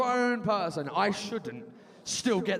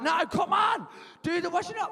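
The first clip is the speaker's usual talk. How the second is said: high, exasperated protest; 9 semitones higher than usual. Acted. Grown son arguing with his mum over chores, exasperated. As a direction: push back, pitched up and insistent.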